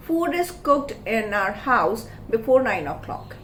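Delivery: slow dictation of example. Shown as a demonstration. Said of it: "Or I can even skip the doer".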